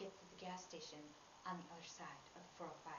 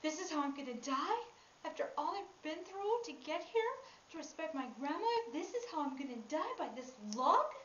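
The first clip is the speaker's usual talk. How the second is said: loud, high disbelief at dying this way; incredulous, raised-voice outburst; indignant inner thought; 7 semitones higher, 12 dB louder than usual. Retold or imitated. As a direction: protest your fate at high volume. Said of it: "I'm thinking in my mind".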